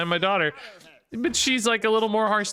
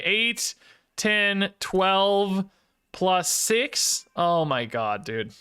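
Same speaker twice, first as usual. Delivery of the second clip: usual speaking voice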